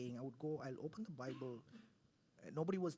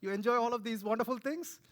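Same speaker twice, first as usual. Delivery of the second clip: rising, much louder and higher, laughing with delight; God's voice; 8 semitones higher, 12 dB louder than usual. Retold or imitated. God delighting in Ranjit's joy, booming and high. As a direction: build to a joyful, booming cry. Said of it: "God said".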